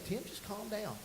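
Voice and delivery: soft imitation of a reassuring coach; lightly acted, hushed reassurance; calming coach. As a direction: quietly settle a panicking student. Teacher calming him in chokehold.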